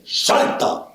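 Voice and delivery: paratrooper captain's sharp command; one forceful, hoarsely shouted word of command; energetic order. Retold or imitated. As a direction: imitate a captain ordering the jump. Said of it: "daba enérgicamente la orden".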